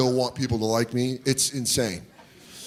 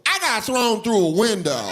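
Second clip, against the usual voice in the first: mock woman's voice; 6 semitones higher than usual. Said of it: "you hear a woman go".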